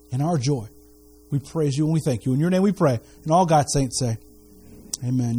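preacher leading prayer, hushed and earnest. Acted. Leads the congregation in prayer.

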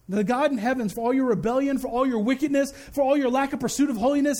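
ordinary talk, nothing marked

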